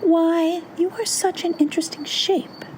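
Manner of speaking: high-pitched storybook bird voice; high crow character voice; crow talking. A narrator's reading. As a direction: speak high as a curious crow. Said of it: "said the crow".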